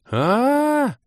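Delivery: high, loud drawn-out scream; shrill sustained wail; piercing shriek. A narrator's reading. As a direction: shriek in shock. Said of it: "пронзительно завопила Кира".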